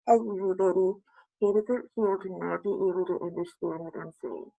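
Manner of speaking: muffled behind a hand; hand over mouth. Shown as a demonstration. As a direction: speak through a covering hand. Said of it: "I'm gonna put my hand over my mouth".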